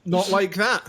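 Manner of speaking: lightly voiced exasperated dad; imitating impatient dad. Dad's retort in retold quarrel.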